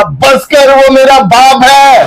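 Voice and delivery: a hammed-up retold shout to stop him. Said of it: "मैंने जोर से चिल्ला के बोला". (ज़ोर से चिल्लाकर रोकते हुए, नकली चीख)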